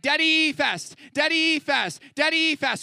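put-on high, loud little-kid pleading; imitating toddler son. Dad imitating toddler Levi begging, high and loud. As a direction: chant it like an eager two-year-old. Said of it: "he's like".

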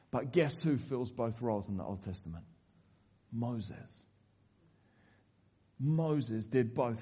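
low, soft, intimate sermon voice; hushed preaching. Acted. Preacher drops to a hush to tell of Moses. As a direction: draw them in, barely above a murmur.

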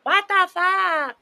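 high, loud, slow mock outburst; cousin's shocked cuss. Prim cousin cussing at the game, loud high mock outburst. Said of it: "she was like".

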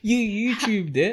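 high, slow incredulous question; can't believe she looked it up; surprised disbelief. Real, not acted. Disbelief at googling the ending.

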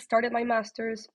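usual speaking voice

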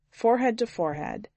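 ordinary delivery, nothing marked

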